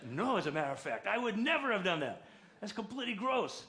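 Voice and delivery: high, loud mock indignation; hammed-up disgusted refusal, building after the first words; emphatic denial. Dad's emphatic denial, retold.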